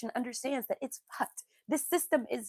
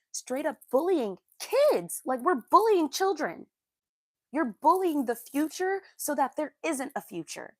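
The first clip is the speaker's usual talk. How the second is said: raised, higher, indignant voice; real indignation; 5 semitones higher, 6 dB louder than usual. Real, not acted. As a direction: hit it loud with outrage at the bullying. Outraged at kids being bullied.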